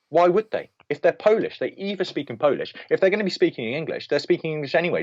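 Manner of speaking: exasperated rant. Real, not acted. Film critic complaining about put-on Polish accents in films, exasperated. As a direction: argue it insistently, pitch climbing in exasperation.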